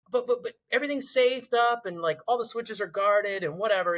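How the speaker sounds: high, stammering mock panic; protesting in a high, panicky voice; freaking out. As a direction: imitate a flustered crewmate insisting. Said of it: "And he's freaking out".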